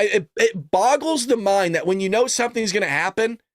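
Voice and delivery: ordinary talk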